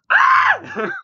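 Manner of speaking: sudden high shriek of surprise; startled scream. Acted. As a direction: shriek as if caught off guard. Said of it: "she sees you and she's like".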